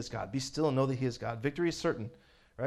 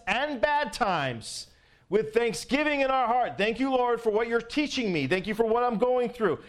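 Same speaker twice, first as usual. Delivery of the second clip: preaching at full force; 2 semitones higher, 7 dB louder than usual. Acted. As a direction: build the sermon to a ringing climax.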